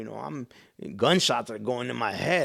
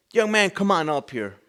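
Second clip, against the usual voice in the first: raised, higher imitated preacher call; preacher's summons; 6 semitones higher, 6 dB louder than usual. Preacher calls a young man up, loud summons. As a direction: call him up from the front.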